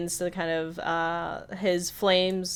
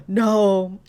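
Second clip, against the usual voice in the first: louder appalled cry; shocked no; 3 semitones higher, 8 dB louder than usual. Retold or imitated. Mabel and Candy reacting to the kiss, loud shocked no. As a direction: cry out in dismay.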